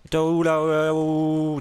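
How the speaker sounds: mock yell. Gamer yells at an enemy sneaking past, loud.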